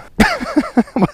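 squeaky burst of laughter; high laugh. Real, not acted. Amazed by the stick bait.

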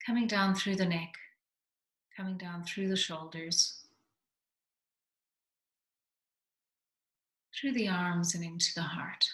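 soft, calm meditation voice, dropping to a whisper at times; soothing grounding meditation; guided meditation. Acted.

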